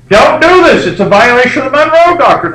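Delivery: high, loud, scolding warning; stern American warning. Retold or imitated. Americans warning the English off Venezuela, high and scolding. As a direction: wag a finger at the English. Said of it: "we say it in England".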